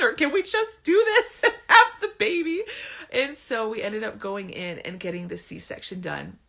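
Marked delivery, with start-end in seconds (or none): 0.0-2.6 laughing plea. Retold or imitated. Pleading with doctor, laughing.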